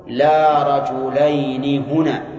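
drawn-out, half-chanted example sentence; slow example. Shown as a demonstration. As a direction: read the example very slowly for the class. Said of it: "إذا قلت".

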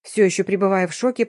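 plain reading voice

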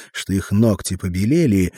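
ordinary delivery

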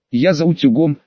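ordinary delivery, nothing marked

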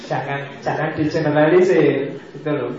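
normal talking voice

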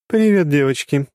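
high, giggly greeting; cheerful hello to the girls; giggling goddess. Acted. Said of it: "хихикнула тёмная".

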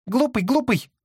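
very high squeaky pigeon voice; squeaky toy. A narrator's reading. Pigeon repeats.

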